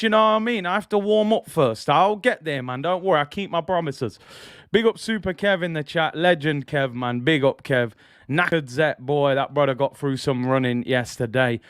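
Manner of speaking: ordinary talk, nothing marked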